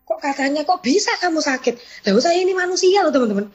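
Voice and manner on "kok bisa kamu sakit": 1 mengulang omelan bos. Retold or imitated. Mengulang ucapan leader.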